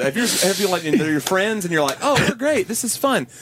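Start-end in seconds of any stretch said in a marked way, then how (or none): 2.0-3.3 high, delighted exclaiming; mock enthusiasm. You, before learning they talk in movies, delighted. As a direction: gush happily about new friends. Voicing liking your new friends.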